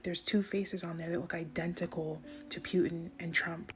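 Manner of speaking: ordinary talk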